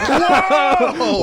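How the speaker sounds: high, loud drawn-out whoa; hammed-up astonished cry; mock shock. Audience reacting to the heckler going straight, mock shock.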